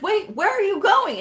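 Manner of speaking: startled question. Retold or imitated. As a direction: call after someone walking out. Administrator startled as she leaves.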